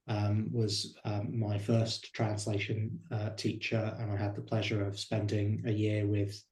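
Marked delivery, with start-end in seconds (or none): none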